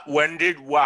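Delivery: ordinary talk, nothing marked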